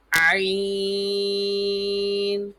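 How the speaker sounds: عین squeezed from the throat, held long and high; thin throat ain. Shown as a demonstration. Teacher models for pupils, long throaty عین. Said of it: "حلق سے آواز نکلے اس کی".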